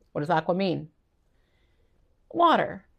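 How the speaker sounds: normal talking voice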